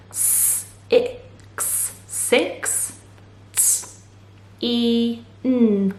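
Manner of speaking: "S, x, six, t, e, n" as separate phonics sounds; sounding out. Shown as a demonstration. Teacher sounding out sixteen for children, sound by sound. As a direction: say each sound alone, voiceless consonants soft, then blend six. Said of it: "Let's sound it out".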